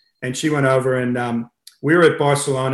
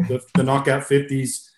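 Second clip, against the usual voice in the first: normal talking voice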